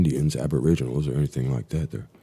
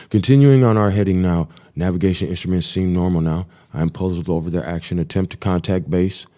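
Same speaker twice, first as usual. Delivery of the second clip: steady, slightly raised log-reading voice; diary reading; 3 semitones higher, 6 dB louder than usual. Acted. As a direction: read the flight log like a pilot's report. Reads flight log again.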